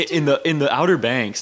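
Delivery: ordinary talk, nothing marked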